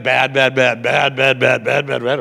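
low buzzing "bad bad" to mimic a phone vibrating; low mock phone buzz; imitated phone buzz.

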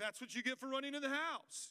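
mock bad cop. Dad scolding crying kid, high mock scold. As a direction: play the unsympathetic dad. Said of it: "And I'll be like".

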